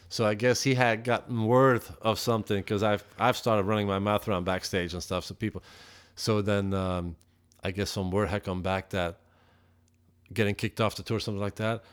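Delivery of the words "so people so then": ordinary delivery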